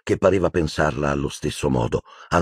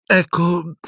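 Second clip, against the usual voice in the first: high, hesitant stammer; faltering girl; 12 semitones higher than usual. A narrator's reading. Hermione stalling under questioning, high faltering voice. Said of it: "balbettò Hermione".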